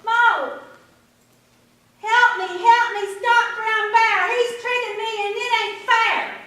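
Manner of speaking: high loud shout for Mo; rabbit calling out. A narrator's reading. Rabbit shouts for Mo down the trail, high and loud. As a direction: call loudly down the trail.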